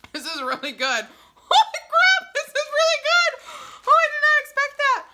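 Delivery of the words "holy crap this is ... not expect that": very high, loud amazed squeal; excited squealing. Real, not acted. Reviewer stunned by Parmesan garlic popcorn, excited squealing. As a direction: burst out high and loud, half laughing.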